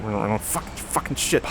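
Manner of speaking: low, slow mumbling cuss; mock muttering. Mocking the woman mumbling curses at everyone, muttered. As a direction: curse under your breath. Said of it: "mumbling under her breath".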